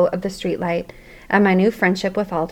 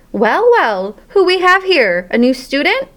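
big hearty boom, a bit higher; 7 semitones higher, 4 dB louder than usual. Acted. Janitor greeting a new student with a booming voice. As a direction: janitor booms a greeting at the startled hamster. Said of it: "a voice boomed".

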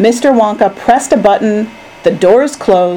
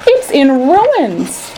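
high, slow, drawn-out lament; distressed cry; 6 semitones higher, much slower than usual. A narrator's reading. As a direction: wail it slowly. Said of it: "cried poor Mister Bucket".

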